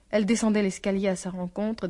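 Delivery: plain reading voice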